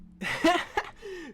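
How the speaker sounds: a short high burst of real laughter; laughing out loud at the twist. Real, not acted. Recapping the movie to viewers, laughs at the twist, a high burst of laughter.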